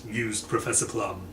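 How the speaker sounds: usual speaking voice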